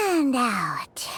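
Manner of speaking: whispered breath cue. Acted. Paimon whispering a breathing cue to relax.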